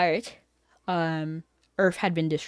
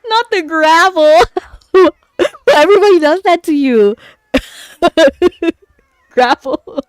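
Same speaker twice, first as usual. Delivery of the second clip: laughing loud tease; 8 semitones higher, 12 dB louder than usual. Real, not acted. Streamer teasing a viewer about the nickname Gravel, laughing loudly. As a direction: howl the nickname and crack up.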